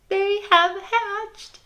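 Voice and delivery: high, slow, crying despair; wailing. Acted. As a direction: wail it like it's a disaster. Gerald wails at hatched chicks.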